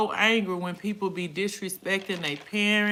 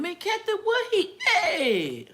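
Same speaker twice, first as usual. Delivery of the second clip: very high, stretched-out holler; laughing, drawn-out call to a friend; playful shout; 12 semitones higher than usual. Real, not acted. Playfully shouting question to viewer.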